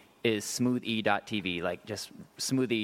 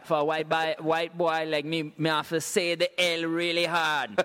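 mock Jamaican patois, a bit raised in pitch; Jamaican accent; 3 semitones higher than usual. Shown as a demonstration. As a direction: put on a Jamaican lilt. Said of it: "this is how I know how to do Jamaica".

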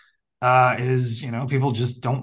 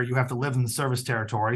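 ordinary talk, nothing marked

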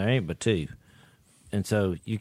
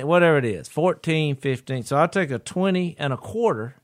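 usual speaking voice

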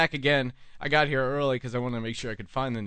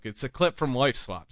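normal talking voice

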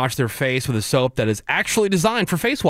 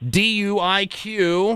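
slow drawn-out title call; game show announcer; 7 semitones higher, much slower than usual. Acted. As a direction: stretch the title like a host. Host says game title.

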